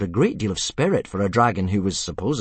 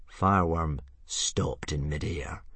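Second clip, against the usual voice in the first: usual speaking voice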